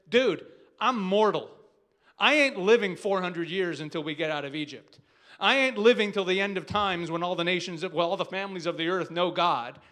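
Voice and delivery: loud, forceful brother protesting; Esau's blunt protest. Retold or imitated. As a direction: belt it out as Esau pushing back. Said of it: "And Esau says to him".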